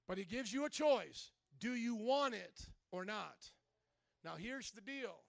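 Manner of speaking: normal talking voice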